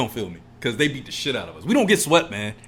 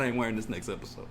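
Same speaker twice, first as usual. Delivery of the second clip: ordinary talk, nothing marked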